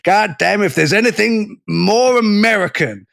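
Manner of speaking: hammed-up Texan accent. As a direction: put on a big Texas accent. Mock American outrage, quoted.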